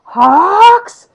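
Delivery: loud, high, rising call; yell. Retold or imitated. Endeavour calling out to Hawks mid-battle, yelling. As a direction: yell the name, stretched and climbing. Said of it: "And Endeavor yells".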